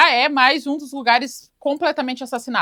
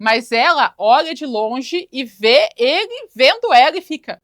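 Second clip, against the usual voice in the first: normal talking voice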